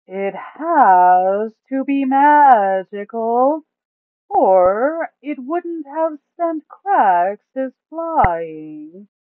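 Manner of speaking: high, loud, slow put-on voice for the greedy Duke. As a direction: drag the words out, high and loud, savouring the loot. Duke reasons about crystal.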